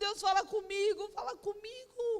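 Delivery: high, begging repeat of fala comigo; retold crying plea. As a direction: beg God through remembered tears. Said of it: "E eu fiquei chorando".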